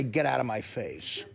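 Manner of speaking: cold dismissal. Pretend. Brushing off the girl.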